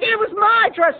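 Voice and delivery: screaming aunt. Retold or imitated. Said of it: "they were fighting over".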